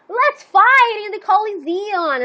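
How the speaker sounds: lightly voiced little kid challenging everyone; kid's battle cry. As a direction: stretch out a high, playful rallying call. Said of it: "a little kid running around".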